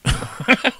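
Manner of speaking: breathy high laugh. Real, not acted. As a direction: crack up at your own story. Laughs at his story.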